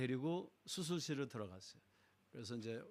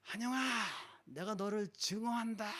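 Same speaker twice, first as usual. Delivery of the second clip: much higher, slow, strange raging voice; possessed sister; 8 semitones higher than usual. Retold or imitated. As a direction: curse them out like something else is speaking through her. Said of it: "저한테 이제 이렇게 하더니 누나가".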